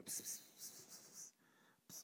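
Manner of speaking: a hissed psst again and again, barely there; imitating a whispering friend. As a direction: hiss to get his attention without waking her. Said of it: "He's still whispering".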